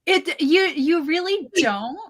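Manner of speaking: ordinary talk, nothing marked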